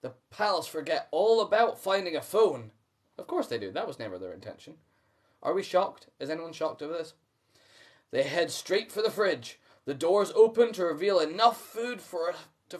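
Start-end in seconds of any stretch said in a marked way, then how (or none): none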